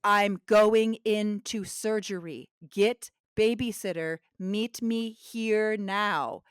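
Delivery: spelling it out slowly; each word said slow and clear; slow and deliberate. Retold or imitated. She tells her husband slowly over a bad line. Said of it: "And I'm like".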